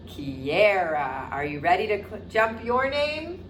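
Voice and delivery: usual speaking voice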